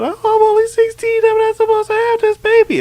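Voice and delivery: very high, loud squeaky falsetto; mock girlish wail, rushing at the end; mock teenage girl. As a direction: whine it like a panicked teen, hammed-up. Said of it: "she's sixteen and she's going".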